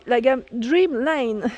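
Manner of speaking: la gamme Dreamline in a hammed-up English accent; put-on English accent. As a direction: show off a playful English accent on the brand name. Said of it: "J'aime bien faire mon accent".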